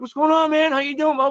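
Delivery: high-pitched friendly hello; retold eager greeting. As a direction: replay hailing an old colleague. Acting out his greeting to Ralph.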